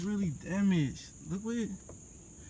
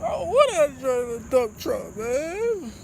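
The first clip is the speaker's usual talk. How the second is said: louder wailing mock sob; crying to viewers about driving a dump truck; 5 semitones higher, 6 dB louder than usual. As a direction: wail it like a hammed-up cry. Fake crying about the car.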